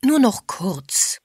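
slow, drawn-out little plea; mildly begging, words held long; pleading. A narrator's reading. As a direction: stretch it out coaxingly. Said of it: "bettelte Agatha".

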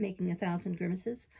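ordinary delivery

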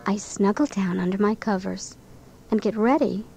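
ordinary delivery

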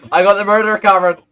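loud, high urgent shout; urgent call. Real, not acted. Player alerting Cameron to the caught murderer, urgent shout. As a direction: shout it to get his attention. Repeats to get Cameron's attention.

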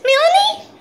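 very high, loud squeal of the name. Real, not acted. Baby talk, squeaky high.

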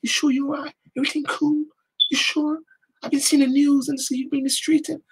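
high, hushed, fussing questions; concerned inquirer. Retold or imitated. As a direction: imitate a worried caller, soft and high. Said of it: "Someone said".

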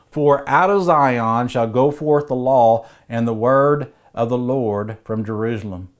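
usual speaking voice

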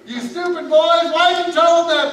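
high, loud, punchy yelled scolding; father yelling at his sons for their blunder; angry father. Acted. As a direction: yell it at the boys without pause. Dad scolds sons again.

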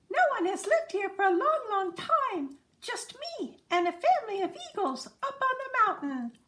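high, fast, squeaky chatter; a friendly little lizard rattling on eagerly. Acted. Agama man telling the dassies about the eagles, squeaky and fast. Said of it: "a squeaky voice called out".

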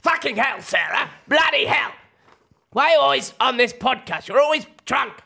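loud, high exasperated rant; Brian Hanson. Acted. Husband berates Sarah for being drunk on the podcast, exasperated shouting impersonation. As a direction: shout your complaint in sharp bursts. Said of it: "Brian Hanson".

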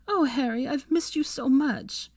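high, drawn-out affection; heartfelt reunion. A narrator's reading. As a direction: gush to a long-missed friend. Emotional reunion with close friend.

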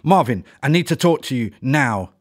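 loud demanding call to the owl; bawling. A narrator's reading. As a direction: bawl it out, loud and urgent. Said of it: "Ella bawled out".

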